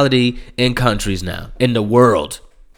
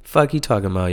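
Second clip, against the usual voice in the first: audience muttering; 3 semitones higher, 8 dB quieter, faster than usual. Retold or imitated.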